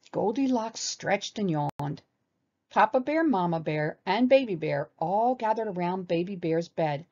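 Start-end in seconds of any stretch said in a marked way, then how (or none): none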